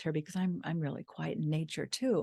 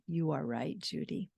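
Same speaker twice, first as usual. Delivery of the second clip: tender whisper; 3 semitones lower, 7 dB quieter than usual. Retold or imitated. Husband agreeing with wife.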